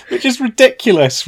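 high, amused indignation; mock outrage. Real, not acted. Co-host mocking the Doctor Who accent ban, amused indignation. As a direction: pretend to be scandalised, laughing.

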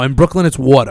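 water said Brooklyn style; Brooklyn accent. Shown as a demonstration. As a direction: Brooklyn accent, "waw-tuh". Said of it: "the Brooklyn accent".

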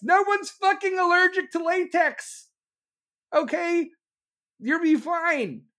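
high, loud scoffing outburst; incredulous rant. Real, not acted. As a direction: scoff at the excuse at the top of the voice.

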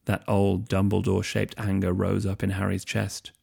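plain reading voice

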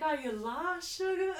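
put-on creepy old Southern man, half laughing; Reverend Kane. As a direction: imitate a creepy old man coaxing a child. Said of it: "Just like an old man".

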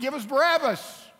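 loud, high mock crowd shout; crowd's demand. The crowd demands a prisoner from Pilate, acted shout. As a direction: call it out like a demanding mob.

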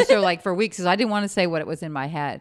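usual speaking voice

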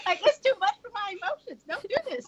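high, laughing protest; laughing mock distress. Laughing as a reader begging the book to stop. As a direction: giggle through a pretend plea. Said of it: "So I'm probably like her, like".

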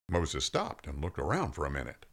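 ordinary talk, nothing marked

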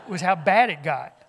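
laughing loudly through it; can't hold back the laugh. Real, not acted. A preacher to his congregation, laughing loudly.

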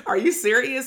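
high, loud retold disbelief; incredulous. Student reacting to supervisor asking about slang, incredulous. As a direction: blurt it out as if astonished.

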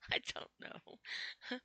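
slow breathy whisper through a giggle; giggly whisper. Real, not acted. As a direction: whisper it while still laughing. Giggly aside after laughing.

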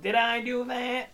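high, drawn-out catchphrase squeal; put-on goofy nerd voice, very high; slow, hammed-up sitcom impression.

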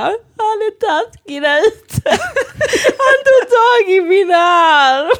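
high, drawn-out wailing complaint; mock crying. Tearful bartender tells colleague about the customer, bawling comically. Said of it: "började gråta".

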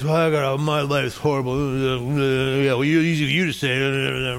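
low, grumbling mock self-pity; mock complaining. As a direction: imitate someone moaning about life, mumbling it away. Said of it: "if you are convinced".

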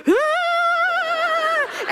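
long high-pitched mock scream; drawn-out high "aah" of fright; startled mock scream. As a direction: imitate mom's scream, held high. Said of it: "And she screamed".

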